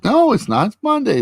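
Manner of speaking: snappy, hammed-up denial; insistent Wanda. Wanda correcting the kids, high indignant insistence.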